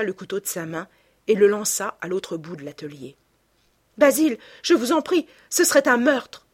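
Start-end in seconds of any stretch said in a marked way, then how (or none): 4.0-6.5 loud, high-pitched begging; choked, desperate plea. A narrator's reading. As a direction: cry out in horror, begging him to stop. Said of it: "Suffoquant de sanglots". (supplication désespérée, forte et aiguë)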